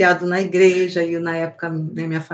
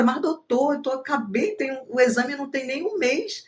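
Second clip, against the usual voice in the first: indignant protest; 6 semitones higher than usual. Pretend. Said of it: "eu ia falar na mesma hora".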